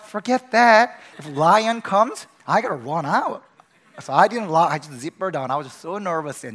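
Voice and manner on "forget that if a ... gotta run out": loud, high, panicked retort; retelling a frightened yell at the guide; panicked outburst. Retold or imitated. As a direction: blurt it out in alarm at the lion. Panicked reply to lock instruction.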